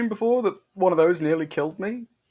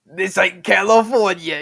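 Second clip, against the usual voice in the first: tough guy voice; 6 dB louder than usual. Pretend. Mock tough-guy line, watching show.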